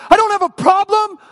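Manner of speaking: high, loud outraged retort; acted furious shout, pitch way up; angry yelling at God. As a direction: yell it back in disbelief. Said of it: "like I yelled at God".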